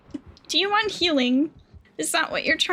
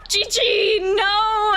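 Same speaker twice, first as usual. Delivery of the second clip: a high, loud cry of alarm at a teammate; alarmed shout; 6 semitones higher, 4 dB louder, much slower than usual. Real, not acted. To Gigi as the killer grabs her, alarmed shout. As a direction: yell her name in alarm.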